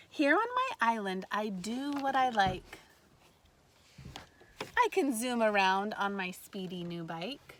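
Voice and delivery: bright, lively read-aloud voice; reading to children. A narrator's reading. Teacher reading a picture book to kindergartners, lively read-aloud. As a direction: read it like story time for little ones.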